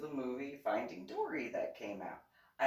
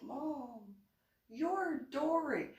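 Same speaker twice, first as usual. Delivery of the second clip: put-on a much higher, slowed kid's voice; child's voice; 13 semitones higher, much slower than usual. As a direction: say it like a matter-of-fact little boy. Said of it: "he's like".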